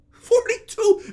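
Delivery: high, drawn-out disbelief; incredulous. Acted. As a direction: repeat the age in stretched-out astonishment. Incredulous reaction to her age.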